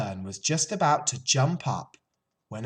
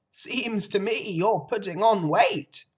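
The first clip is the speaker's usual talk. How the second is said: put-on higher, louder old sheep, blunt; 11 semitones higher, 6 dB louder than usual. A narrator's reading. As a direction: needle the pig as the old sheep.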